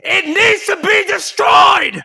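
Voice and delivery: real all-out yell; shout. Real, not acted. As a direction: bellow it out at the peak of the rant. Rant peaks, shouted repeat.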